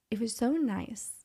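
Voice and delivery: hushed quote of a touched husband, fading towards a whisper; soft tender retelling. Retold or imitated. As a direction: retell it softly, letting the voice drop away.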